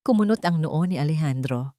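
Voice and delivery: usual speaking voice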